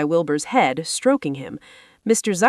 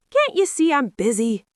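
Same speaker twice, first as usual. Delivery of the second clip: disgusted; 7 semitones higher than usual. A narrator's reading. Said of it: "replied Avery in disgust".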